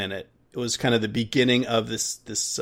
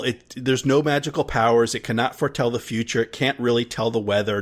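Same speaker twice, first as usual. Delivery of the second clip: ordinary talk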